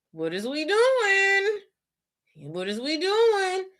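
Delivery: high, slow, stunned question; drawn-out high disbelief; flustered. Retold or imitated. Kip stunned as Hunter calls him down, slow high bewilderment. Voicing Kip's flustered surprise.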